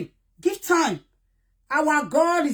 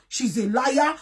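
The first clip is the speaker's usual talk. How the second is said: normal talking voice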